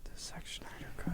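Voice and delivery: breathy hushed whisper. Pretend. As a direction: whisper it back. Echoing the whisper.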